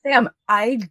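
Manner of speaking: mock-defend the burger run, half laughing; husband defending himself. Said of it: "He's like".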